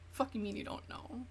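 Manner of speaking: hissed retort, voice dropped to a whisper; angry whisper. Retold or imitated. Girlfriend snapping back at her cheating boyfriend, whispered. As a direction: whisper it like a furious comeback.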